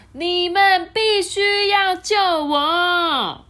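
high, loud, slow, drawn-out demand; furious and stubborn; angry princess. Acted. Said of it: "公主非常的生气哦". (公主生气地拖长声音喊)

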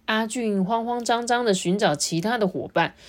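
usual speaking voice

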